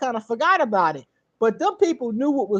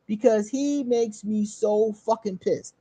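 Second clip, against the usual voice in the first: normal talking voice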